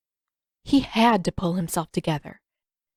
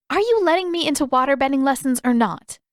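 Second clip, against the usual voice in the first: impatient demand; 6 semitones higher, 4 dB louder than usual. A narrator's reading. Katara presses Pakku impatiently.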